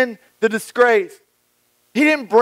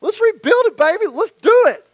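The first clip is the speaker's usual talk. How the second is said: much higher, hyped-up eager voices; excited crowd; 4 semitones higher than usual. Retold or imitated. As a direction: pump it up like a cheering crew. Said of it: "So everybody's like".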